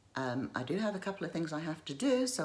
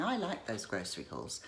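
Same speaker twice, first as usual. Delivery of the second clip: usual speaking voice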